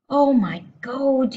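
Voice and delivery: slow, high, loud horror; yelled. A narrator's reading. Father staring at himself in the mirror, yelled. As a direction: yell it drawn out in dismay. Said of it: "yelled the father".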